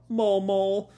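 high little mole call; hypnotized mole. Retold or imitated. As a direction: chirp it like an entranced mole. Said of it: "Pino crawling to the ground still".

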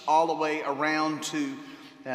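normal talking voice

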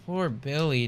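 ordinary delivery

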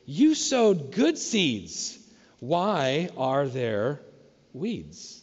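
slow, drawn-out puzzled question; paraphrasing the servants, slow and baffled. Retold or imitated. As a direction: spell out the confusion slowly. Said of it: "In other words".

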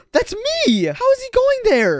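high-pitched astonished cry; gasp, then squeak in disbelief; shocked gasp. Acted. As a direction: voice shooting up in shock. Sees his own body.

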